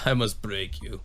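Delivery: low cold Russian boxer voice; a hint of a menacing Russian boxer; Ivan Drago. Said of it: "Ivan Drago".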